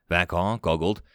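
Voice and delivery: plain reading voice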